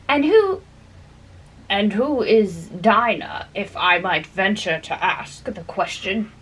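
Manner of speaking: prim Lory voice. A narrator's reading. Lory's prim question.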